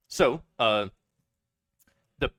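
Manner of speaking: ordinary talk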